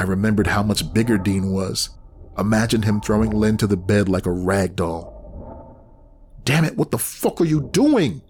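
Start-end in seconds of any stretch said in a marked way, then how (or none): 6.4-8.2 shouted. Acted. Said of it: "I shouted into the phone".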